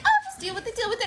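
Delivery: high, breezy brush-off; dismissive mom. Retold or imitated. Mom brushing off her daughter's complaint, high and breezy. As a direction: wave it away like an unbothered mom.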